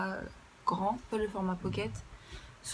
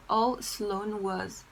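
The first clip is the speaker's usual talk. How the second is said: slow, careful reading in French-accented English; deliberate English, French accent throughout; much slower than usual. Real, not acted. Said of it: "je déteste mon accent anglais".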